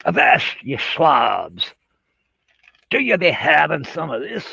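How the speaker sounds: mock pirate hailing a crew, pitch swinging; piratenrol. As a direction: play a pirate raiding the gas station. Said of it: "bandito mask on and say".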